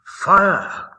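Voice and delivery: loud, high cry of Fire; alarmed cry. A narrator's reading. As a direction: shout the alarm like a frightened housewife. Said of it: "cried Missus Prothero".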